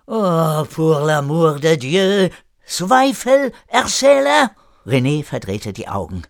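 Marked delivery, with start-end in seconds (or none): 0.1-4.5 put-on high, loud French-accented exasperation; exasperated. René fed up with Erik's doubts, exasperated French accent. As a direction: exclaim in French-accented despair at him.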